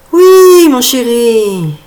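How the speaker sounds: mother calming her son, slowly; tender mother. Acted. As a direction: soothe the boy gently.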